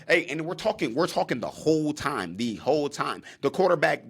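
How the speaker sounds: ordinary talk, nothing marked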